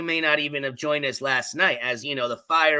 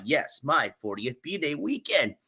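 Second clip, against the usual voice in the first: normal talking voice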